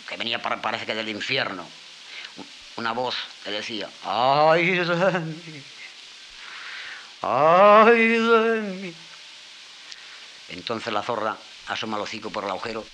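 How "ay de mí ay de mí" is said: very slow, much higher, louder wailing lament; trembling voice from below. Acted. Trapped serpent moaning from under the rock, slow trembling wail. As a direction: draw out each cry like a voice from the underworld. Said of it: "trémola".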